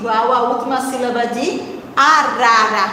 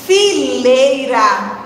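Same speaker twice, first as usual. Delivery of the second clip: "fileira" stretched slowly syllable by syllable; drawn out; much slower than usual. Shown as a demonstration. Teacher reads the answer option to children, drawn out. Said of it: "Se eu parar bem pra pensar".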